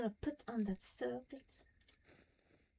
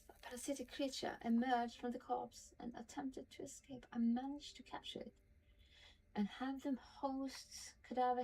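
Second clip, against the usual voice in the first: reading a journal entry aloud, a little higher, measured; diary reading; 3 semitones higher, 4 dB quieter than usual. Acted. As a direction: read it like a private diary, steady and serious. Reads Halsin's diary aloud.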